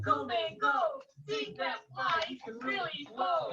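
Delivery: cheering shout. A narrator's reading. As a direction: cheer the fly on like a wild fan. Cockroach shouted.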